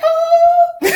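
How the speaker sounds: loud, very high delighted ooh; high-pitched whooping "ooh". Retold or imitated. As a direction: let out a piercing, gossipy "ooh!". Retold gleeful reaction to best line.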